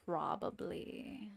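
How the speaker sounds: slow, whispered "Probably." with a rising end. Real, not acted. Streamer musing to herself over her drawing, slow whisper. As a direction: breathe "Probably." slowly, as a musing question. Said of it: "slow whispered repeat".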